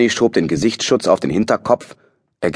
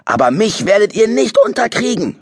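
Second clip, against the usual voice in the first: defiant shout; 14 semitones higher than usual. A narrator's reading. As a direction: scream defiance at the sky. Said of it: "schrie er".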